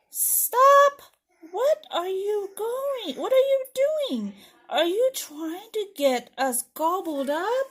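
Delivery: high, urgent protest after a loud stop; alarmed story character. Acted. Scared trick-or-treater stops a friend at the spooky house, alarmed. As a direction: play a panicked trick-or-treater for children.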